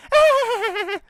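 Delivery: high, bright cackling laugh; joey laughs. Acted.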